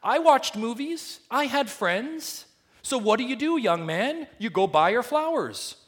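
high, loud impassioned preaching; preaching at full force. Real, not acted. Preacher to church, preaching with full force. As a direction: preach it with rising fire. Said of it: "impassioned preaching".

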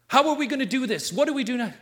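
high, loud, urgent questions; questioning congregation. Acted. Preacher voices the church asking how to go on, urgent questioning.

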